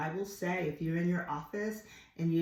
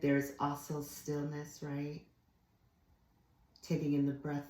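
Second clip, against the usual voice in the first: ordinary talk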